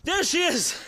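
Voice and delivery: high, loud excited exclamation; excited reveal. Real, not acted. Host revealing Plymouth Rock, loud and excited. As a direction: announce it with delight.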